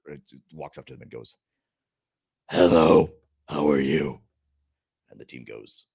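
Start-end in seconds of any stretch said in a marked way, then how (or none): 2.5-4.2 loud, odd alien-leader greeting; strange voice. Acted. Strange leader greeting the visiting team, odd loud voice. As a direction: greet loudly in a put-on odd voice. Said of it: "that's a strange voice you have".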